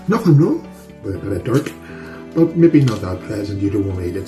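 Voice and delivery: ordinary talk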